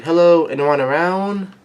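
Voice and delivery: mock visitor's rising call. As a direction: call through a doorway to see who's there. Acting a visitor calling out.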